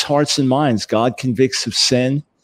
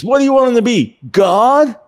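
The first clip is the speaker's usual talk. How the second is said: much higher and louder, hammed-up exasperation; 13 semitones higher, 5 dB louder than usual. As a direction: throw up your hands, pitched way up.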